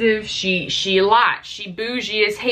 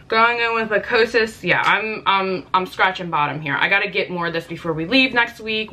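ordinary talk

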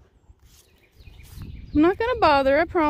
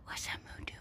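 whisper; 16 dB quieter, faster than usual. Real, not acted. Hushed near the baby animal.

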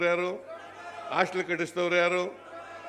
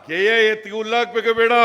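ಗೆ ಎತ್ತಿಗೆ ಹುಲ್ಲಾಕ್ಬೇಕು ಬೇಡ said higher and louder, laughing through it; laughing retort; 5 semitones higher, 9 dB louder than usual. Real, not acted. Speaker to rally crowd, laughing louder rhetorical question. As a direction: throw the folksy line back at the crowd, laughing. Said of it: "repeated louder with a laugh".

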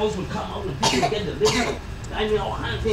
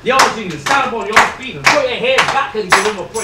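loud, building call to praise; rally the congregation at full force; rousing preaching; 5 semitones higher, 12 dB louder than usual. Real, not acted.

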